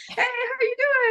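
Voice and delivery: cheerful father-in-law. Retold or imitated. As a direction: greet her all happy and carefree. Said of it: "And he was all happy".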